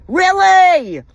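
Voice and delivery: high, loud, drawn-out "Really?"; incredulous outburst. Real, not acted. Wife ranting about husband's mistress, incredulous outburst. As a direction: cry it out in outraged disbelief. Angry rant in disbelief.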